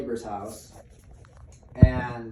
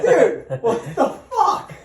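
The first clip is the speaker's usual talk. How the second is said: much higher, louder, slow and full of laughter; laughing disbelief; 10 dB louder, slower than usual. Retold or imitated. Quoting his reaction to the smoking dad, laughing hard. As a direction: squeal it out through helpless laughter.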